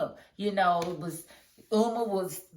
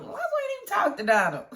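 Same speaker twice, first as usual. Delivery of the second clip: laughing squeal; 13 semitones higher, faster than usual. Real, not acted. Laughing about Alonzo.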